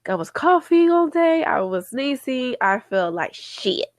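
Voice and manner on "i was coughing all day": ordinary talk, nothing marked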